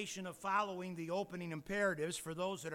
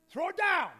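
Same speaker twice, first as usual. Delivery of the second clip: high, loud, commanding God; a high, loud divine question; God's voice; 13 semitones higher, 11 dB louder than usual. Acted. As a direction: call down as God to Moses.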